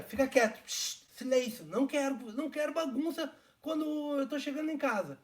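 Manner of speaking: loud, high shushing of noisy dogs; scolding. Retold or imitated. Re-enacts scolding his noisy dogs.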